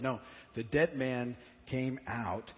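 usual speaking voice

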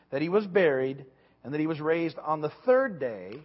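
loud, deliberate Bible reading; scripture reading. Acted. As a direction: proclaim the passage to the congregation. Reads Scripture aloud.

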